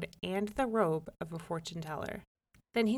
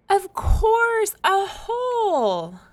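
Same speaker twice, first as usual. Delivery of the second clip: very high, loud, drawn-out joy; delighted cry; 13 semitones higher, 12 dB louder, much slower than usual. A narrator's reading. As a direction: cry out in sudden relief. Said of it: "The lady brightened".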